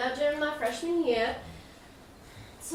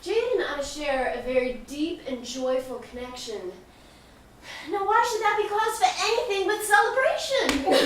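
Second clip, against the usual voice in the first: ordinary talk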